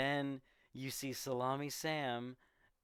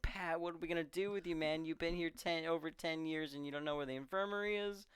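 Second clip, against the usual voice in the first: mock reproach, pitched up and rattled off; acted exasperated scolding; 5 semitones higher, faster than usual. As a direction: chide him in a higher voice, imitating. Acts out Roy's exasperated scolding.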